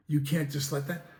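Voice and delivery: ordinary delivery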